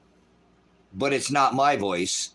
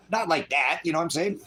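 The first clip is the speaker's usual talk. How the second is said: ordinary talk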